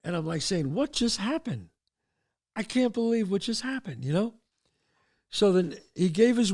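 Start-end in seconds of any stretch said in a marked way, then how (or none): 0.7-4.1 softer, higher-pitched musing; stunned disbelief. Retold or imitated. Stoned teen walks home alone, hushed stunned disbelief. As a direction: wonder aloud to yourself, dazed. Dazed self-talk, acted.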